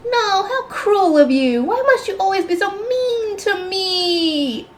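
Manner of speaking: spoiled sister playing the victim, slow and high; wounded little sister. A narrator's reading. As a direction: whine it like a wronged innocent. Jill fake-crying at her sister.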